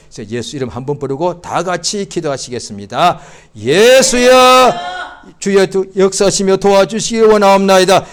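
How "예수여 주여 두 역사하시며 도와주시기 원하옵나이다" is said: fervent loud prayer. Acted. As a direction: cry out the prayer with full force. Leads loud congregational prayer.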